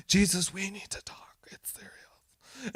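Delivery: whispering urgently to Jesus; hushed secretive aside; mock whisper. Martha pulls Jesus aside, urgent whisper. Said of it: "he's like".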